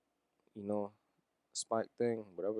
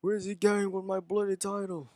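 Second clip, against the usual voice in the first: high, loud put-on English accent; Barrett's English accent; 12 semitones higher, 5 dB louder than usual. Barrett demanding where his title is going, loud English accent. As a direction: bark it out in a British wrestler's English accent. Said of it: "with that English accent".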